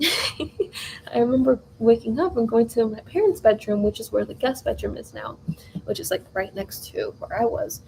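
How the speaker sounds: ordinary delivery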